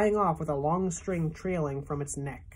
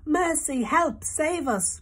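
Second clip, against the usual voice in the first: much higher, a frantic squeaky plea for help; frightened cry; 11 semitones higher, slower than usual. A narrator's reading. Ladybug cries in panic as the cloudman descends, high and frantic. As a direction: cry out in panic like a tiny bug. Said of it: "cried the ladybug".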